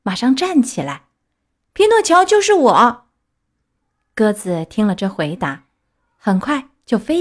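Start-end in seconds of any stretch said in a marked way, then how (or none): 1.7-3.1 put-on high, loud eager boy; a high, loud puppet crying out that it's him. A narrator's reading. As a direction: proudly announce himself, voice up high. Pinocchio's voice.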